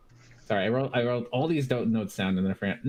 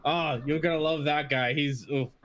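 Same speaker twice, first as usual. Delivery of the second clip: much higher, hooting mock-laughing dwarf; high cackling dwarf teasing; 6 semitones higher than usual. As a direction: tease the guests to your buddy. Dwarves mocking, laughing.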